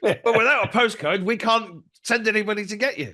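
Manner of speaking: high, loud whiny official voice; mocking tax officer. Mimicking the frustrated tax lady on the phone, high and whiny. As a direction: squeak it out like an exasperated tax clerk.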